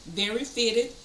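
talking while chewing gum; words round a piece of gum. Real, not acted. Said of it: "I'm chewing gum".

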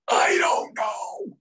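high, drawn-out imitated scream. Huge client asked why he's mad, imitated long scream. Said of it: "He screams that".

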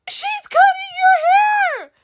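very high, loud alarmed shout; shocked shriek. Acted. Character yelling to her friend in alarm.

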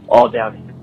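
ordinary talk, nothing marked

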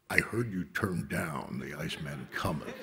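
low, measured phone confrontation; stern director. Retold or imitated. Director calling actor who turned down the film, stern. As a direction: drop the voice low, as a disapproving director.

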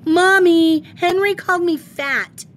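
high, loud tattling wail; a little sister tells Mommy her brother called her fat, loud and shrill; child screaming. Acted. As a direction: shriek it to Mommy like a wronged little kid. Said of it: "ran into the kitchen screaming".

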